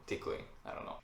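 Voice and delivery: normal talking voice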